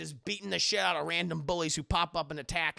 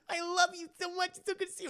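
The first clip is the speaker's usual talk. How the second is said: very high, gushing; gushing girl; 13 semitones higher, faster than usual. Retold or imitated. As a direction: swoon with relief. Kiko overjoyed.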